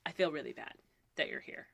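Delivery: soft, fading apology; apologetic director. Retold or imitated. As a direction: quote his awkward apology, trailing off low. Retold director's awkward apology.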